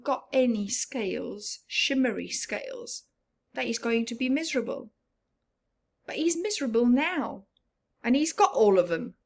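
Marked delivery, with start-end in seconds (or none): none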